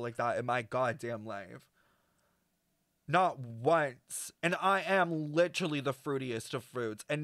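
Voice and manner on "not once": ordinary talk, nothing marked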